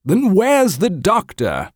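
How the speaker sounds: high, slow, insistent demand. Acted. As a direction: press him for an answer. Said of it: "she demanded".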